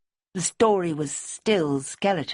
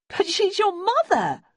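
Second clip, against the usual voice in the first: higher, louder, slower exclamation; bursting out; 4 dB louder, slower than usual. A narrator's reading. Simmy bursts out at Debbie in shocked disbelief. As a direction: blurt it out in disbelief. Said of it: "Simmy burst out".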